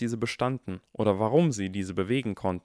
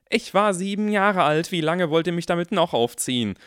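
put-on higher, louder exasperated boy; exasperated protest; 8 semitones higher, 6 dB louder than usual. A narrator's reading. Harry protests to his teasing parents, exasperated and loud. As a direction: complain loudly at the teasing.

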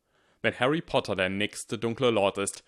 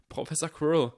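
blurted out; 7 semitones higher than usual. A narrator's reading. Harry cries out to his teacher in fear. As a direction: burst out with it in fear. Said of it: "stieß er aus".

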